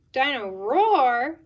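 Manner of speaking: loud, drawn-out roar on the name; slow, booming child's exclamation; excited little George. Acted. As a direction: stretch out ROAR like a delighted toddler. George repeats the toy's name.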